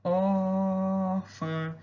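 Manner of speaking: "Órfã" with the first O held very long; drawn-out stressed O. Shown as a demonstration. Teacher shows students the tonic syllable, "Órfã" with a long held O. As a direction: sing out the "Ó" of "Órfã", then a short nasal "fã". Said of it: "você demorou mais no O ali".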